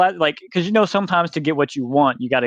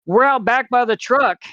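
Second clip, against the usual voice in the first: calling out across the shop; 10 semitones higher than usual. Retold or imitated. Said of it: "I hear my name being yelled".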